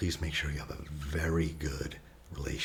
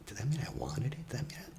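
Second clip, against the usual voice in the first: higher-pitched, imitated distressed self-questioning; voicing self-loathing; 6 semitones higher, faster than usual. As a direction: act out her anguished self-doubt. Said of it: "And they hate themselves".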